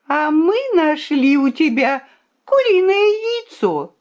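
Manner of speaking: high-pitched exposing jab; triumphant accusation. A narrator's reading. The princess exposes the rooster's hidden egg, accusing.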